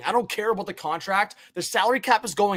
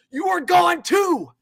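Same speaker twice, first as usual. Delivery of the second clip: stern warning; 9 semitones higher, slower than usual. Real, not acted. Warning Pettersson.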